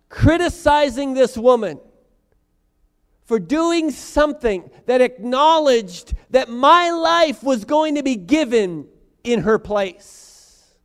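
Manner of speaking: high, loud preaching at full force; preacher building to a loud, impassioned peak; fiery preaching. Acted. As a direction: drive the point home to the congregation. Preaching as Jesus to diners.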